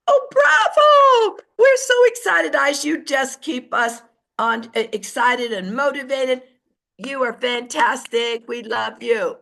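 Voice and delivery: over-the-top excited praise, then settling; gushing cheer. Shown as a demonstration. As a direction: gush over them like superfans.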